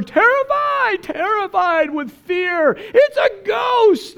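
high, slow terrified cry; hammed-up frightened shout; mock terror. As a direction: shriek it like you've seen a ghost. Preacher acts out disciples' terror.

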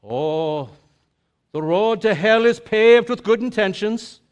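lightly voiced grandmother's saying; Irish grandmother. Grandmother quoting her proverb, lightly voiced granny. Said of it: "my Irish grandmother would say".

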